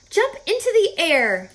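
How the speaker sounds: high, loud call; crying out. Acted. Charlotte urging Wilbur to jump, crying out. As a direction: cheer him into the air. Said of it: "cried Charlotte".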